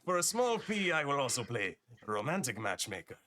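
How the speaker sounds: high, falling phrases in a put-on accent; accented merchant offering his services; accented merchant Marcus, salesman's pitch. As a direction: pitch it like a dealer, starting high.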